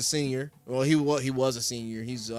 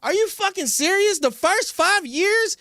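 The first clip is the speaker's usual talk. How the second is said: high-pitched, incredulous rant; outraged disbelief; 11 semitones higher than usual. Real, not acted. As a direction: blow up at the absurd take, pitch way up. Outraged at TikTok guy's ranking.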